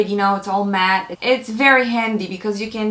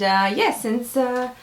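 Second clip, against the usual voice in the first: ordinary talk, nothing marked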